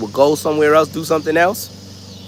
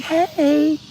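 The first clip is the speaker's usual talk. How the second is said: put-on high, sweet child's hey; little girl voice; 14 semitones higher than usual. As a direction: greet like a small girl, much higher. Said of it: "She's like".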